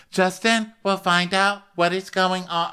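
high-pitched imitation of a castmate; put-on high quoting voice; high mock voice. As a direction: act her out well above your own pitch. Acting out Whitney's reply.

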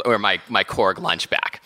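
ordinary talk, nothing marked

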